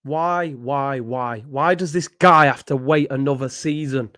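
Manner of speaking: ordinary delivery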